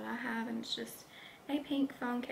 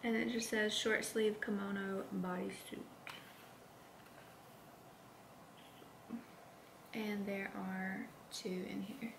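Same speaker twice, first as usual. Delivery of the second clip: whispering; 4 dB quieter than usual. Real, not acted.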